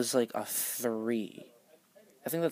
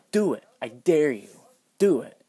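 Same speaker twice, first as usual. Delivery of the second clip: daring taunt; 7 dB louder than usual. Retold or imitated. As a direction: goad her to strike. Friend daring teacher to hit.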